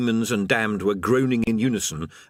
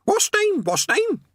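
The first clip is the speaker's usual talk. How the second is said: very high, squawked parrot cry; squawking parrot; 3 dB louder, slower than usual. Acted. Another voice to the lads, a very high parrot squawk. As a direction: squawk it like a bird.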